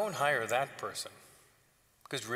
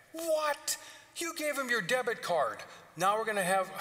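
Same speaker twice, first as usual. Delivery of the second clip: shrill disbelief at the father; indignant older brother; 9 semitones higher than usual. Acted.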